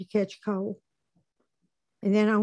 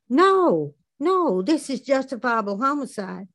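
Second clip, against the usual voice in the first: loud, high drawn-out "No"; insistent self-justifying outburst; emphatic mock protest; 3 semitones higher, 3 dB louder than usual. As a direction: argue back indignantly, easing after the first "No". Acted argument with voice in head.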